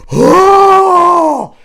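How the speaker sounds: loud high shocked Oh; shocked crowd cry. Retold or imitated. Retelling the audience's reaction to the pantsing, loud shocked shout. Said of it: "hear the audience go".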